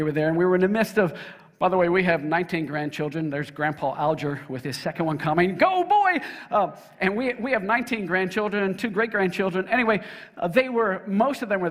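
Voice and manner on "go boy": quick high shout of encouragement; playful cheering call. Real, not acted. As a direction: cheer him on from the pulpit. Cheering on grandpa in crowd.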